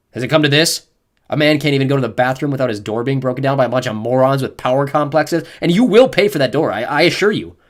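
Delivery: loud, forceful outrage; indignant tirade. A narrator's reading. Dad berating officers who broke his door. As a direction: scold them like fools.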